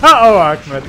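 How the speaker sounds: loud high laugh; cracking up at a friend. Real, not acted. Laughing at teammate's blunder.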